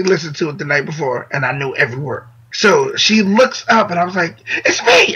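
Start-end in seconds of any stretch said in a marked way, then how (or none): 4.6-5.1 very high excited squeal; excited calling out. Retold or imitated. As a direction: squeal to catch your idol's attention. Calling out to idol from store.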